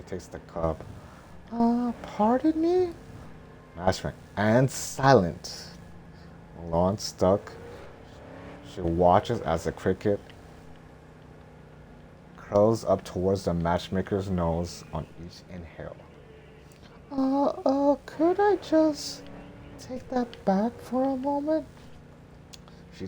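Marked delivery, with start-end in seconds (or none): 1.5-2.9 put-on very high, breathy young heroine; high girl voice. Mulan's voice. 17.1-21.7 put-on very high, breathy young heroine; high girl voice. Mulan's voice.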